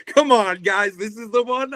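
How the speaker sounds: pleading with buyers, voice jumping way up; mock exasperated plea. Artist pleading with fans to buy the good shirt, high and whiny. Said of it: "And I'm like".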